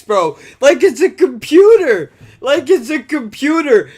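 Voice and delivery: high, loud, disbelieving rant; incredulous yelling. Retold or imitated. Retelling his reply to a woman on the phone, high disbelieving yell. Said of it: "I go".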